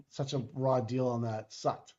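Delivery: normal talking voice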